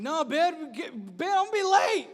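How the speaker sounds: high, loud comic panic; mock panicked protest. Sleeper telling his bed he will be late, mock panic. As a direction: burst out in exasperated protest, playing it up.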